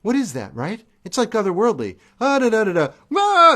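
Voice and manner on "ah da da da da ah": high, loud imitated scream sung in syllables; showing a singer's crazy scream; mock rock scream. Shown as a demonstration. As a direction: belt out a crazy wailing da-da scream. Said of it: "and then he does his crazy scream".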